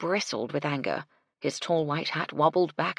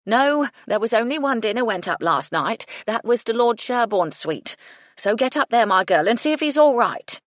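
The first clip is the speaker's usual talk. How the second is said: loud call over clattering pans; yelled over the din; 6 semitones higher, 8 dB louder than usual. A narrator's reading. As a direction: shout across a noisy kitchen. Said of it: "she yelled at Gertie".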